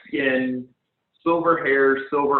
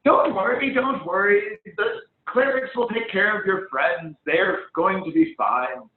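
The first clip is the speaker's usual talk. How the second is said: a higher, lightly voiced reassuring halfling; reassuring laugh; 8 semitones higher than usual. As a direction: pat his shoulder and brush off his worry. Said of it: "laughing and says".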